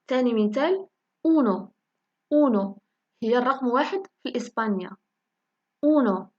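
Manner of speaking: ordinary delivery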